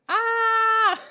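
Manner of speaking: high loud shriek of surprise; startled scream. Real, not acted. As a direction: shriek as the cannon pops. Confetti cannon goes off.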